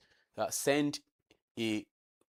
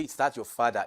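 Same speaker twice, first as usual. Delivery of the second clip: "father" brought down at the end; falling intonation. Shown as a demonstration. Teacher naming the wrong tone for questions, falling intonation on "father". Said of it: "it is falling tone".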